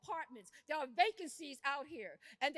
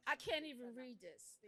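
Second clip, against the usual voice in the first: quieter, lower, holding back distress; too upset to read; 5 semitones lower, 5 dB quieter than usual. Real, not acted. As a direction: voice sinking as it gets to her. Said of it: "I'm upset".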